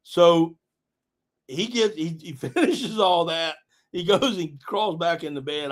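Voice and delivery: ordinary talk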